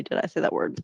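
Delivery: geflüstert, verlegen; flüstere es ertappt, leise; sheepish whisper. Real, not acted. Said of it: "Oh, sorry".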